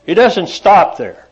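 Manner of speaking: normal talking voice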